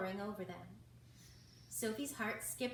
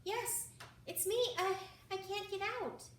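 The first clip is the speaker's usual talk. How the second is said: high squeaky little voice; 10 semitones higher, 3 dB louder than usual. A narrator's reading. Mouse stuck in a hole, squeaking up to Owen. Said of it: "she squeaked out".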